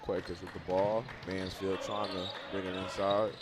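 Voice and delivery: brisk basketball commentary; live play-by-play. Acted. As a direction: call the action as it happens.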